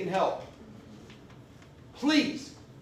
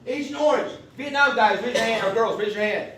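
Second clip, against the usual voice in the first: loud, raised-pitch call to the room; 8 dB louder than usual. Real, not acted. Speaker salutes veterans in the audience, calling out. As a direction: hail them loudly, landing hardest on the welcome.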